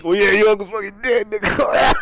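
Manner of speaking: high, hammed-up yell; mock dad shout. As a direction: blurt the news at full volume. Acting dad blurting out the death.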